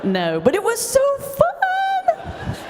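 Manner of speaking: very high and drawn-out giddy squeal; gleeful squeal. Real, not acted. Minister admitting to the crowd her retort was fun, high giddy squeal. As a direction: squeal it out with delight. Gleeful confession of a petty win.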